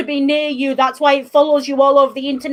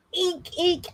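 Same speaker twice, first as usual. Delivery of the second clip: squeak; 5 semitones higher, 8 dB quieter than usual. Retold or imitated. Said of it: "and I just heard".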